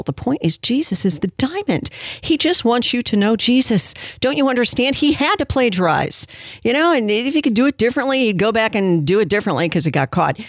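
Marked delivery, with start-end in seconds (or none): 0.0-8.2 higher, gushing mock defence; sarcastic pleading. Host pretending to defend the plagiarizing pastor, sarcastic pleading. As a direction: plead his case in a high, exaggerated voice.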